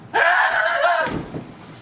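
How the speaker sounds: sudden high startled laugh; a quick surprised high-pitched laugh. Shown as a demonstration. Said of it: "and I did this".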